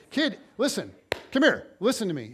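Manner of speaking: retold insistent summons; urgent command. Acted urgent orders to the kid.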